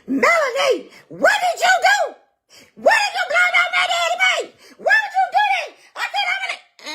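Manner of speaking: shrill mocking falsetto rant, cracking up; mocking a hysterical texter. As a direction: squeal it like a frantic daughter, laughing at her. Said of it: "What you said in the group chat was".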